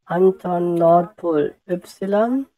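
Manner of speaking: slowly echoing while typing; slow repeating. Real, not acted. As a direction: drag each word out as you type. (langsam mittippend nachgesprochen)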